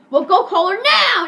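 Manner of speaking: loud, high yell stretched on the last word; hammed-up furious command; retold teacher's order. Teacher orders Eric to call mom, loud mock yell.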